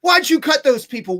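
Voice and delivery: hammed-up clerk yelling the question again. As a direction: push the repeat harder, full mock shout. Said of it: "starts yelling at me".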